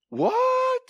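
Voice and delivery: high, surprised squeak; preacher's high mock outrage; mock disbelief. Real, not acted. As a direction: feign shock at the doubt. Preacher reacts to doubters.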